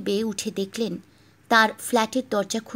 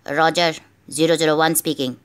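phone voice; 6 semitones lower, 4 dB louder than usual. Acted. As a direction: lower voice over a phone line. Agent answers on secret phone.

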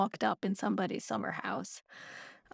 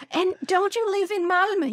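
chatty neighbour; 8 semitones higher than usual. Retold or imitated. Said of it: "And she said".

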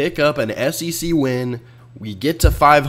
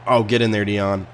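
normal talking voice

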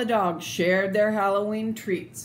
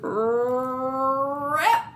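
higher, louder drawn-out rip; ripping sound; 6 semitones higher, 3 dB louder than usual. A narrator's reading. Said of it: "sound of ripping".

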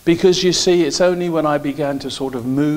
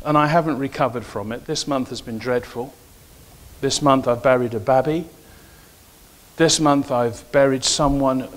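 ordinary talk

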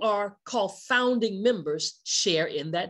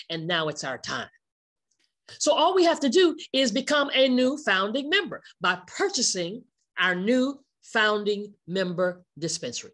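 usual speaking voice

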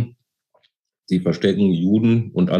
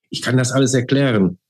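higher, pleading, a touch of panic; desperate cry; 7 semitones higher than usual. A narrator's reading. Charlotte begs the Gestapo officers, mildly desperate. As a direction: blurt it out in desperation. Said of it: "rief Charlotte verzweifelt".

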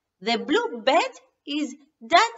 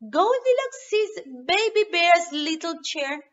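plain reading voice

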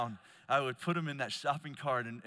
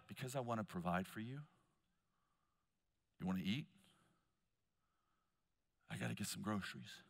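lower, much softer and quicker, calmly reassuring; gentle father; 8 semitones lower, 11 dB quieter, faster than usual. Acted. Father reassuring his daughters in the cart, soft and low. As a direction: explain it softly like a patient dad.

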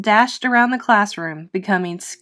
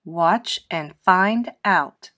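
slow mysterious; slower than usual. Acted. Frankie's mysterious promise.

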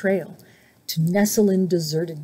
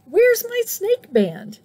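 small snake voice, high and slow; 12 semitones higher, slower than usual. Acted. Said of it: "he asked".

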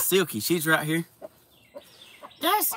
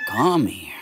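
whisper; 4 semitones lower than usual. Real, not acted. Whispered to animal.

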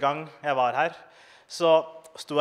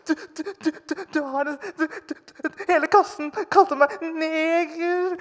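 mock sobbing kid; 13 semitones higher, 4 dB louder than usual. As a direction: stammer it through fake tears. Comic voices his sobbing child self.

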